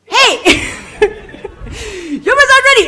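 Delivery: very high, loud call; shouting. Retold or imitated. Massage lady shouting right next to the praying client. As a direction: bellow it right beside her. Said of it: "comes right next to me again".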